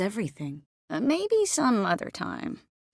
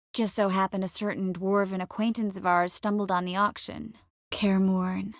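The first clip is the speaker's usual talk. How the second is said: normal talking voice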